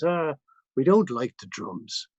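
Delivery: drawn-out mock Irish accent; terrible Irish accent. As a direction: imitate a rock singer in bad Irish. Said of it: "excuse my terrible Irish accent".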